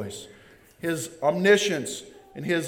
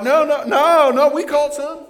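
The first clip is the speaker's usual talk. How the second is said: higher, louder protesting fishermen; mock fishermen; 8 semitones higher, 6 dB louder than usual. Fishermen lying to the stranger on shore, higher protest. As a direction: fib like a caught fisherman.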